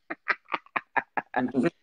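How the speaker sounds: real staccato chuckle; chuckling laugh. Real, not acted. Speaker amused that southerners rarely dare curse, chuckling. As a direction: let out a run of short chuckles.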